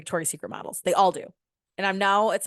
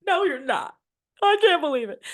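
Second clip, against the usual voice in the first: mock crying; 5 semitones higher, 4 dB louder than usual. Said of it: "I'm, like, crying".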